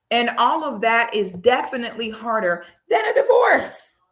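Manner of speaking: hammed-up exasperation, voice climbing high; exasperated rant. Real, not acted. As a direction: plead in high-pitched disbelief. Exasperated rant at the celebrity couple.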